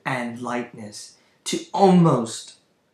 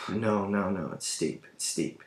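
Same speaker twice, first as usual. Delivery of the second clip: hushed insisting; 4 semitones lower, 5 dB quieter than usual. Acted. Edgar insisting quietly.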